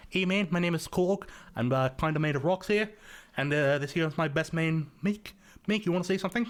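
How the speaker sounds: gentle rocky giant introducing his buddy; Korg impression. The original plan for the orc voice, Korg impression. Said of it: "that Korg impression from, like, Thor Ragnarok".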